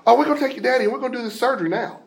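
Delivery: rattled off fast and high, offhand; casual quick doctor; breezy fast. Retold or imitated. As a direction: toss it out like routine news. Doctor casually announces surgery.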